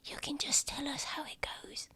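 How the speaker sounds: high, very soft voice; whispering little child. Acted. Child asking adventurer for news, high soft whisper.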